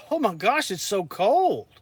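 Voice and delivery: high, loud mock dismay; exaggerated cold-weather complaint; mock shivering complaint. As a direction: imitate visitors whining about the cold. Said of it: "because they say".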